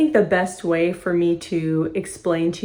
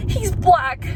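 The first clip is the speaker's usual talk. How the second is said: high-pitched mock panic; freaking out; 11 semitones higher than usual. Brett finally caves to Jules, high mock-panicked voice. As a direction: blurt it high, imitating a terrified man. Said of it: "he's, like, freaking out".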